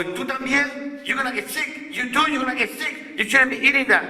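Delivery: high-pitched mock scolding; scolding the dogs. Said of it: "And I go".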